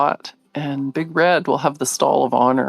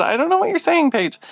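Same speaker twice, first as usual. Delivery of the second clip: puzzled daughter; 11 semitones higher than usual. Retold or imitated. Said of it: "She said".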